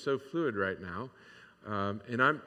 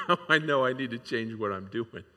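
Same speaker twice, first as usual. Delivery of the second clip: amused chuckle running into speech; laughing; 5 dB louder than usual. Real, not acted. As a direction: laugh through the words. Amused at his own sermon prompt.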